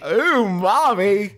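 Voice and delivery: umami stretched slowly and pitched high; drawn-out umami. Shown as a demonstration. Echoing the drawn-out name.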